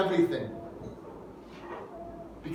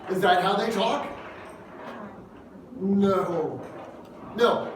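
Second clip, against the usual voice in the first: normal talking voice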